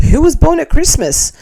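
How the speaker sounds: mock teacher question. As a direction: ask it like a teacher quizzing kids. Teacher's question in read comment.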